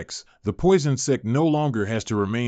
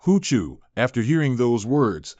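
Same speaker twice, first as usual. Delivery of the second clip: ordinary delivery, nothing marked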